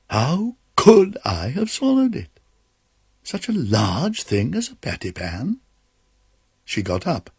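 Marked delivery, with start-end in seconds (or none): 0.1-5.6 wondering Duchess. Acted.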